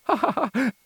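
high staccato ha-ha-ha; laughing. A narrator's reading. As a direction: laugh heartily at your own wit. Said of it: "rise Antonio il freddurista". (risata acuta e recitata)

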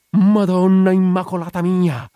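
high, loud cry to a holy image. A narrator's reading. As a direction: shout your plea to the Madonna. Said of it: "gridò all'immagine del canterano". (grido acuto di invocazione)

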